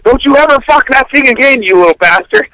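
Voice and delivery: dad scolding, half-hammed anger. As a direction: bark it like an angry dad scolding his son. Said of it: "He says".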